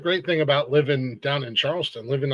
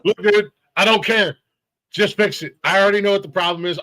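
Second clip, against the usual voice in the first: retold exasperation, raised voice and higher pitch; impatient telling-off; 6 semitones higher, 5 dB louder than usual. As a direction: cut the repairman's excuses short.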